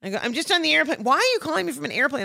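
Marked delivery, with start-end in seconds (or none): 0.2-1.0 high, breezy boast; showy phone chatter. Acted. Caller showing off to sister, high showy chatter. 1.0-2.2 high, fast disbelief; incredulous sister. Acted. Sister to airborne caller, incredulous. Acts sister's surprised phone reply.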